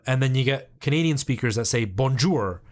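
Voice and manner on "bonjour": 'bonjour' as bon-joor with a hard English R; English final R. Shown as a demonstration. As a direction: close 'bonjour' on a full English R. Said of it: "not supposed to pronounce the R that way".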